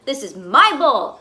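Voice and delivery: loud, slow furious yell; screamed. Acted. Fish screaming at his crowded bowlmates. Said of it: "I screamed".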